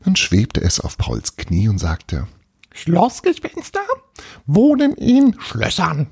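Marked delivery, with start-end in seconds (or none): 2.7-6.1 very high-pitched tiny ghost voice; little castle ghost. Acted. Little ghost tells Paul where it lives, high ghost voice. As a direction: squeak it out as a small, self-assured ghost.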